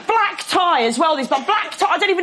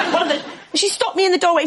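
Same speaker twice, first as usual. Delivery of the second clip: ordinary talk, nothing marked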